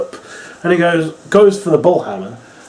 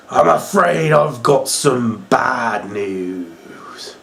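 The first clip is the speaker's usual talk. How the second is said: slow, low raspy imitation of Bad News Barrett; 3 semitones lower, much slower than usual. Vlogger quoting the wrestler's catchphrase, slow raspy impression. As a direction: drag it out in his gravelly catchphrase voice. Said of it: "with his raspy voice".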